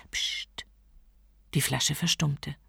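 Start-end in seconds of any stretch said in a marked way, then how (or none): 0.1-0.7 hushed, voiceless "psst"; shushing. A narrator's reading. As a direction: shush them with a sharp psst. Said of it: "legte einen Finger an die Lippen". (leises, tonloses Psst)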